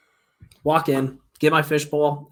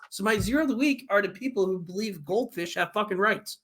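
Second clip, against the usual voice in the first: ordinary talk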